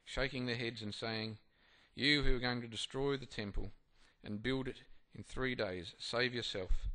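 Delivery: formal reading voice; scripture reading. Acted. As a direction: read the passage out to the group. Reads scripture aloud.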